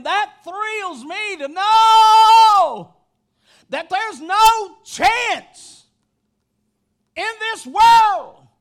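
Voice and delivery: loud, high shouted preaching with held-out line endings; full-force preaching. Real, not acted. Preacher thrilled to be carried home, full-force shouted preaching. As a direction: preach it in driving phrases, stretching the last word.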